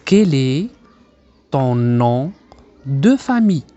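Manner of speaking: slow teacher's model, pausing after each chunk; slow, segmented dictation-like delivery; word-group by word-group. Shown as a demonstration. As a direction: break the phrase into separate pieces for learners.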